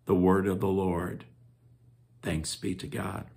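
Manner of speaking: quiet, solemn closing formula; liturgical response. Acted. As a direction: close the reading reverently.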